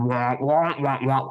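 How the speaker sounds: muffled wah-wah of the Peanuts teacher; lightly voiced cartoon-adult babble. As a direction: mimic a muted trumpet droning on. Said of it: "The teacher from the Peanuts".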